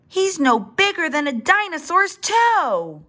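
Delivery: high-pitched incredulous exclamation; astonished. A narrator's reading. Father dinosaur marvelling at his tiny baby, astonished.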